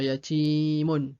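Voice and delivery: yatimun slowly, with the ti drawn out long; slow, drawn-out yatimun for beginners; exaggerated long vowel. Shown as a demonstration. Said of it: "à exagérer au début pour le".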